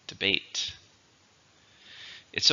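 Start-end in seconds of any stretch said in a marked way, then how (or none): 0.1-0.7 "Debate" with the stress on BATE; stress on second syllable. Shown as a demonstration. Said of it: "stresses on the second".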